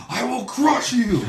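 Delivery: high, loud, slow roaring threat; barbarian roar. Retold or imitated. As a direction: bellow it like a monster. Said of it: "And he goes".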